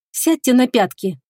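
sighing goddess. A narrator's reading. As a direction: sigh the order out slowly. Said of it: "вздыхает госпожа Шамирам".

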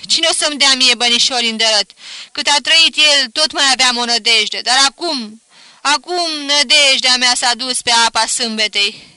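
high, loud protesting wail; wife talks back to husband, loud and high; loud lament. A narrator's reading. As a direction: refuse to be hushed, keep wailing. Mother answers husband, still lamenting.

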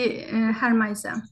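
ordinary delivery

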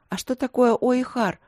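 bashful high question; shy. A narrator's reading. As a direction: ask timidly, embarrassed. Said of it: "застенчиво спросила она".

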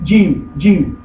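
"Jim" with a j as in English "jam"; "Jim" opening on a d-plus-zh sound; Sudanese pronunciation. Shown as a demonstration. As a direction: say "Jim" with a hard dzh onset, low. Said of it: "wie er den Buchstaben Jim ausgesprochen".

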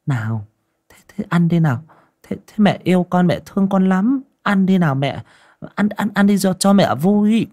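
high-pitched, cajoling plea; coaxing mother. A narrator's reading. Mother begs toddler to eat porridge, coaxing. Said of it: "ra sức nịnh nọt".

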